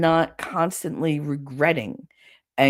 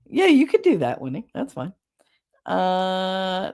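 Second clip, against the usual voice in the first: ordinary talk, nothing marked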